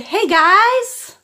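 "Hey, guys" sung out high and loud; high prompting voice. Shown as a demonstration. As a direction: prompt the baby brightly, high.

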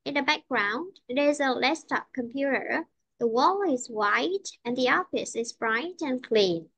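slow model reading, "clean" falling at the end; teacher's demo with "background" up and "clean" down; rise and fall. Shown as a demonstration. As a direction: lift "background", let "computer" and "clean" fall. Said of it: "nên cô đi xuống nha".

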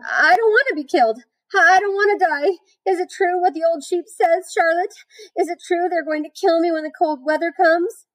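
high-pitched, panicky piglet pleading; frantic scream. A narrator's reading. Wilbur asks Charlotte if he will be killed, panicking. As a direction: wail it high, begging for the truth. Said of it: "screamed Wilbur, racing up and down".

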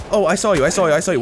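ordinary talk, nothing marked

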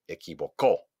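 "equivocó" punched on the last CÓ; stress on final syllable. Shown as a demonstration. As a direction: land hard on CÓ in "equivocó". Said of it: "hago un golpe de voz". ("equivocó" con golpe de voz en CÓ)

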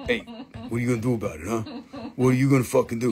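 lightly voiced lizard bully; mock tough taunt. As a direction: goad the viewer like a thug. Lizard figure taunting viewer.

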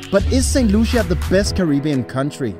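ordinary talk

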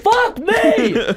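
screamed curse, very high; furious shout. Real, not acted. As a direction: yell it out in pure frustration. Angry outburst at game failure.

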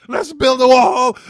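loud, high, drawn-out shout; hammed-up slow yell; mock rallying cry. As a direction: bellow it like a parody slogan.

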